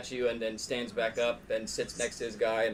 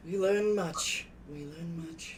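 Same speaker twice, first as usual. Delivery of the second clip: NPC voice; 3 semitones higher, 5 dB quieter, much slower than usual. Acted.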